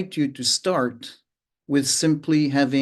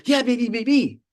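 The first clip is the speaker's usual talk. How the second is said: higher cheeky reply, hammed up; other person, mock; 9 semitones higher than usual. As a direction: play the other talker pushing back. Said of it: "the other person go".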